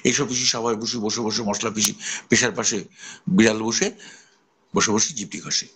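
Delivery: rattled off quickly, স sounds kept clean; fast tongue twister. Shown as a demonstration. Teacher showing students to recite the twister fast. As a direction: say the twister as fast as you can. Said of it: "এটা যদি আমি দ্রুত উচ্চারণ করতে পারি".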